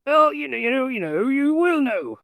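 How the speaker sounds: squeaky high teasing voice; playful high-pitched riddling; mock high voice. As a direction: pitch it way up to tease. Mocking high voice.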